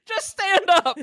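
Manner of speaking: lifted high, teasing impression; playful high-pitched mimicry of the man; high mock voice. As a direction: mimic him squeakily. Mocking the man in the water.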